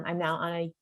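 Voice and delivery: ordinary talk, nothing marked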